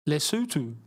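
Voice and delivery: "Lesotho" as leh-SOO-too; actual pronunciation. Shown as a demonstration. Streamer trying out the actual name, careful "Lesotho", leh-SOO-too. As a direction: say "Lesotho" with two OO vowels, falling. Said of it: "How do you actually say Lesotho?".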